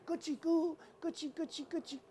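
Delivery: high, soft tickling coo; comforting baby talk. Acted. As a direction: coo playfully to a crying baby. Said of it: "he tried to comfort the baby".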